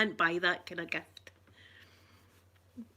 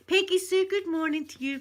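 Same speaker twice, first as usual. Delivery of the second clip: much higher, louder sing-song hello; cooing greeting; 9 semitones higher, 6 dB louder than usual. Real, not acted. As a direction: sing the name out fondly. Greeting a pet by name.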